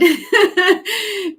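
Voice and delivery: a bright burst of high laughter. Real, not acted. Laughs at the dad mention.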